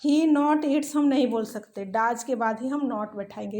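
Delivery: ordinary talk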